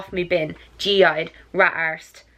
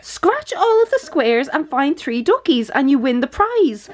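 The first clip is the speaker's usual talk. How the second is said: normal talking voice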